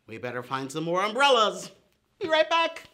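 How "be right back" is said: high, loud call while dashing off; hurried chirpy exit. Acted. Hurrying off to fetch.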